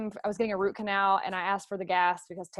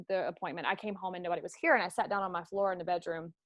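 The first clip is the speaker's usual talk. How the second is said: ordinary talk, nothing marked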